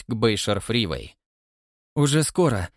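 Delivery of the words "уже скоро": relishing what comes next; keen, raised anticipation; eager anticipation. A narrator's reading. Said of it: "в предвкушении сказала мисс Рейс".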